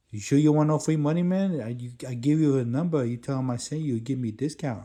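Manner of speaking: high, loud, eager hustler voice; pushy hustler dude. Retold or imitated. Stranger pushing again.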